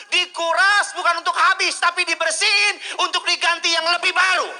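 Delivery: loud, high, booming pulpit voice; full-force preaching. Acted. Preacher rousing the congregation to applaud, booming. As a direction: preach it out to the whole hall.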